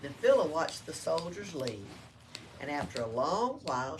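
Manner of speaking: ordinary delivery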